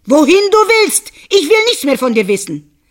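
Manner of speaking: loud, high, fed-up outburst; angry dismissal. Acted. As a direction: send the foolish wife away. Husband angrily dismisses wife. (Mann weist die Frau wütend und laut ab)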